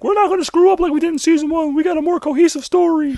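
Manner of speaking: high-pitched squeaky impression of TV writers; mock writing staff. Host mimicking Gotham's writers in an interview, high mock voice. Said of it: "writing staff of Gotham was like".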